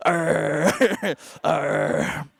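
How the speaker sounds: low, breathy dog growl. Pretend. Speaker voices the dog chewing Charlie's leg, low growl. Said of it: "chewing on Charlie's leg".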